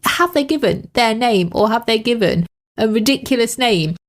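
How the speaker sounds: ordinary talk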